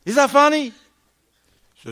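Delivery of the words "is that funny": loud, high indignant question; wife snapping at her smiling husband; wife's sharp challenge. Retold or imitated. Wife demands an answer, loud and high. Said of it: "And she's gonna say".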